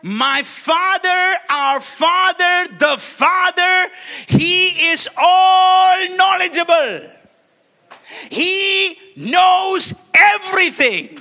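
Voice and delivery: slow, loud, high preaching cry; fervent pulpit shout. Acted. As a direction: proclaim it with full force. Preaching at full force.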